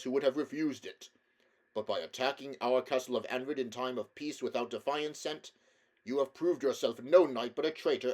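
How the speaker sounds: loud, stately royal rebuke; an old king condemns a traitor prince; stern king. A narrator's reading. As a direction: pronounce judgement like a king. King Lune speaks in character.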